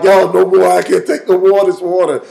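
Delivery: put-on loud, complaining baby voice; a baby begging them to stop; fed-up baby. Dunked baby in the baptism, voiced loud and fed up.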